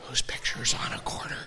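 quick unvoiced whisper; sneaky aside whispered to the front row. Real, not acted. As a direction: whisper it as if you might get in trouble. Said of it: "I told you I'm gonna get in trouble".

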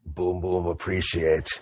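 very low, soft, halting brute; Boom Boom. Acted. Boom Boom thanks the dwarves, low and halting. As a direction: rumble it low with long gaps. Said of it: "he hands it back and he says".

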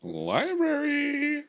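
high and very slow, stretched out; drawn-out high voice. Pretend. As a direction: sing the word out high and long. Joke drawn out word.

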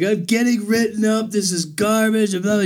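mock-aggrieved griping; worked up, upset. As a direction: whine indignantly as the upset friend phoning mom. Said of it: "he's all worked up and upset".

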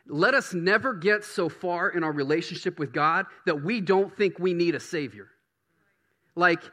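fervent pulpit preaching; preaching at full force. Real, not acted. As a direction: preach it hard to the room. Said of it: "Come on, I'm preaching good".

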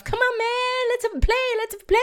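very high, fast chirpy bird; squeaky bird voice. Pretend. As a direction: squeak it out like a hyper little bird. Voicing a playful willy wagtail.

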